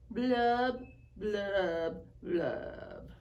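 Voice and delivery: a gloomy fish singing his sad refrain; glum pout pout fish. Acted. Fish answers the octopus.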